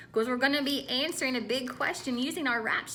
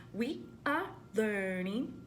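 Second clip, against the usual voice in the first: slow, softer call-and-response echo; echo it back; 3 semitones lower, 4 dB quieter, slower than usual. Shown as a demonstration. Teacher asks the class to echo: slow echo. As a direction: repeat after me, slowly.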